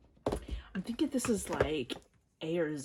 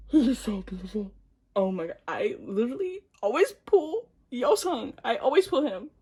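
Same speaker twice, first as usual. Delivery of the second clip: excited gush; 4 semitones higher, 8 dB louder than usual. Real, not acted. Excited over the pull.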